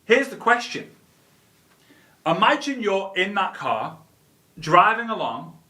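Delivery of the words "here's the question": ordinary talk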